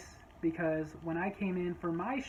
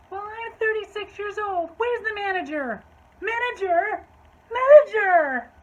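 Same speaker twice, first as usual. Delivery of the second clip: high-pitched holler building to a stretched scream; hammed-up shrieky demand; yelling customer; 13 semitones higher, 11 dB louder than usual. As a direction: call out for help in mock outrage. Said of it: "she's just yelling".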